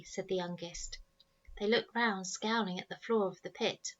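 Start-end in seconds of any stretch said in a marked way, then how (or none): none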